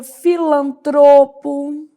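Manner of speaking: slow filantropo, fi-lan-TRO-po; stress on TRO. Shown as a demonstration. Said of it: "a sílaba tônica".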